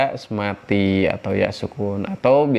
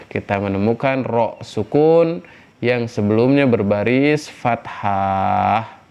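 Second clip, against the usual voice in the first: ordinary talk, nothing marked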